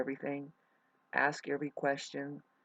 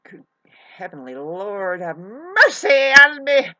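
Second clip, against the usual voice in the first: mock cry to heaven; 3 semitones higher, 16 dB louder than usual. Astrologer begs heaven to free her from the accent, loud and theatrical. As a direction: cry out to the Lord in mock despair.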